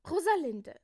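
loud, high call of a name. A narrator's reading. Jakobus summons Rosalinde to hand out tasks, calling out. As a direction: call her name to get attention. Calls her to give orders.

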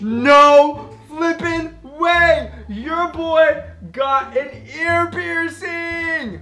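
slow, loud, high disbelieving cheer; elated shout. Real, not acted. Vlogger cheering to viewers about his new piercing, elated slow shout. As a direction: stretch it out in giddy disbelief.

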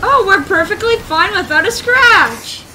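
loud, high, drawn-out sarcasm; mock reassurance. Real, not acted. Gamer after wrecking the car, loud mock reassurance. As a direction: insist on it loudly and slowly.

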